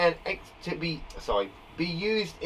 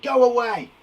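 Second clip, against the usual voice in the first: loud, higher-pitched order; exclaimed command; 4 semitones higher, 7 dB louder than usual. Shown as a demonstration. Teacher showing what exclamation marks do, exclaimed command. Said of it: "because it's exclaiming it".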